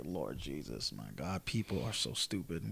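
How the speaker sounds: muttered to myself. Retold or imitated. After the argument, muttering to himself about stupid people. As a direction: grumble it low, mostly to yourself. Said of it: "I'm just like".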